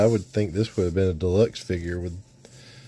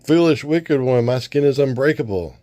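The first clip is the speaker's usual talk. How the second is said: boastful hero; 6 semitones higher, 4 dB louder than usual. Acted. Sunman mocking the spy, high loud taunt. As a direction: scoff at the feeble attack.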